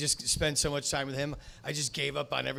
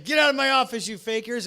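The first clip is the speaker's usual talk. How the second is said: angry producer; 8 semitones higher, 7 dB louder than usual. Retold or imitated. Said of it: "the dude's like".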